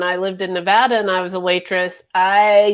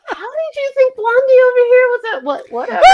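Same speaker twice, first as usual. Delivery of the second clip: incredulous; 13 semitones higher, faster than usual. Retold or imitated. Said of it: "And I was like".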